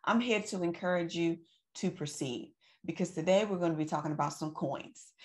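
ordinary delivery

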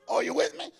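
ordinary delivery

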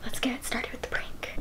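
hushed whisper. Real, not acted. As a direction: whisper to the camera. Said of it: "I have to whisper so she doesn't hear".

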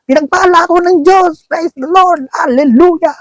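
high, far-off loud worship cries; mock loud praise shouts. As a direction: play the showy worshipper crying out praise. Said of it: "malalakas sumigaw". (pasigaw at mataas na papuri, parang nagpapakitang-gilas)

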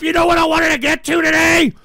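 high, loud exasperated demand; angry shouting. Real, not acted. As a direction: yell the question at the audience. Repeats the question, shouting.

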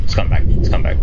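loud hurried plea to turn back; urgent retreat. Real, not acted. Gamer fleeing a shark, urgent and loud. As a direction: rush it out, wanting to flee.

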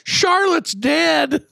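put-on high, drawn-out little girl's voice; imitating daughter. Father imitates his daughter telling him the pet died, high child voice. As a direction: play the kid announcing the pet died. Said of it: "Eleanor's like".

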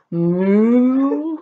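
mock moo asked as a trick; wrong cow moo. Shown as a demonstration. Teacher teases kids with wrong animal sound. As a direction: moo teasingly for sheep. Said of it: "Do sheep say".

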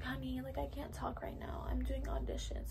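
quoting the house mom in a soft whisper; mock-whispered brush-off into the phone; hushed phone aside. House mom answering daughter's call.

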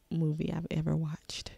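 hushed, breathy close; whisper. Real, not acted. Telling viewers about the film, whispered. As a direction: drop to a whisper to land it. Reactor hushes his verdict.

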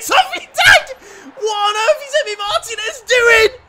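very high, loud incredulous scream; screaming in disbelief. Real, not acted. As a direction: shriek at the keeper's blunder.

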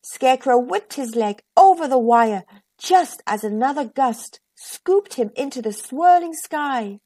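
usual speaking voice

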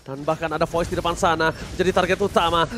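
ordinary delivery

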